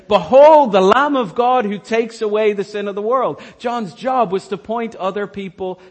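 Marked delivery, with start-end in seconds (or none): 0.1-3.4 proclaiming. Acted. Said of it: "John the Baptist's job was to say".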